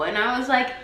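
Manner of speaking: ordinary delivery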